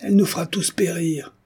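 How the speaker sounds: high-pitched angry outcry; crowd outcry. A narrator's reading. Villagers accusing Hélène of dooming them, crying out. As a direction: cry it out high, like a panicked villager. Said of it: "cria-t-on".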